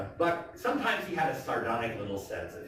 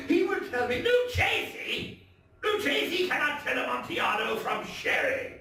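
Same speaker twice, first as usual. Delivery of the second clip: much higher, mocking shout; scoffing drunk Fortunato. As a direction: sneer at the rival with tipsy scorn.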